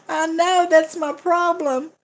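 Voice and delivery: high, slow, laughing admission. Real, not acted. Laughing admission after comment.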